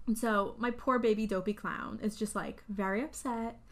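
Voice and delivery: normal talking voice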